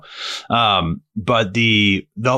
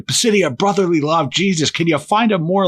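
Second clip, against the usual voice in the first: raised, heckling roast voice; mock comic rant; 8 semitones higher than usual. Retelling a comic mocking Philly, higher heckling voice. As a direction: jab at the crowd from higher up. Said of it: "he's like".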